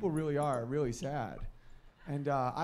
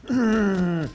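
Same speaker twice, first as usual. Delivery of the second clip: strained grunt; 5 semitones higher, 12 dB louder than usual. Retold or imitated. Dogs straining on leash.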